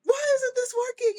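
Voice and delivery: very high mock-crying wail; frustrated. Coach showing adults may be frustrated and cry, frustrated. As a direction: wail it like an upset kid. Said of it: "sometimes we just need to be frustrated".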